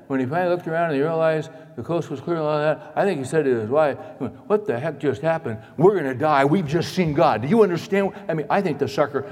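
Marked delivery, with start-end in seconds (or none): 4.5-8.1 panicked. Acted. Preacher acts Manoah blurting to his wife, panicked. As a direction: blurt it out in mock panic, no pauses. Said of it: "I think he was panicked".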